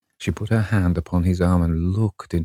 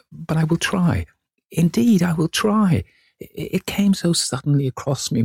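put-on high, pleading girl's voice, anguished; desperate young actress; 9 semitones higher than usual. A narrator's reading. Sibyl begging Dorian not to leave her, high and desperate. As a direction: beg him in a high, breaking girlish voice.

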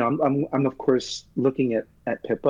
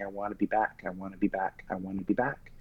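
quiet inner wish; 3 semitones lower, 10 dB quieter than usual. Retold or imitated. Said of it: "I just kept thinking".

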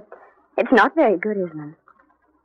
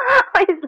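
short high laugh; a fond little laugh; 10 semitones higher than usual. Acted. Anne laughs at him.

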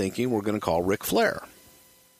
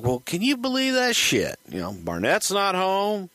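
normal talking voice

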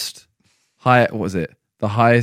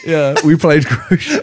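laughing; 5 semitones higher than usual. Real, not acted. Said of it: "mocking the crochet mistake".

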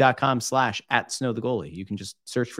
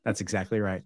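normal talking voice

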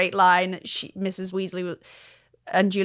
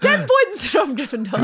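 loud, raised voice docking points; Maggie impression; 5 semitones higher, 5 dB louder than usual. Actress imitating Maggie between laughs, loud impression. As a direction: pronounce it like a grand, loud schoolmistress. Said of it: "can you do an impression of Maggie".

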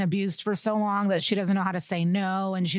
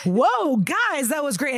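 higher, louder gushing praise; awed theatregoer; 5 semitones higher, 4 dB louder, slower than usual. Retold or imitated. Captain praising detectives like he just saw a play, gushing. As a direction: gush as if after a great show. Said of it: "acts like he just saw a play".

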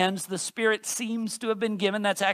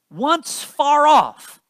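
slow, higher and louder on "once far off"; emphatic reading; 6 semitones higher, 7 dB louder, much slower than usual. Acted. Preacher dwelling on the Gentiles' distance, slow and emphatic. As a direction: stretch "far off" out for weight.

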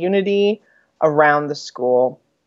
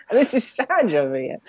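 higher mock Australian-sounding accent; imitated put-on accent; 5 semitones higher, 3 dB quieter than usual. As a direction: echo a friend's dubious Australian-like accent. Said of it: "trying to say that with an Australian accent".

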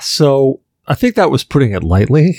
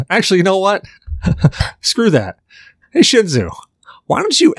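too much caffeine; 9 semitones higher than usual. Real, not acted. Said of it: "I'm wired and I'm giddy".

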